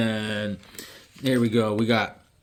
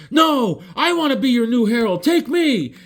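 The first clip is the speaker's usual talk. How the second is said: eager pleading; 10 semitones higher than usual. Retold or imitated. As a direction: plead to be chosen. Cyborg Superman begs Galactus.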